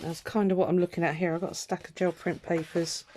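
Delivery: normal talking voice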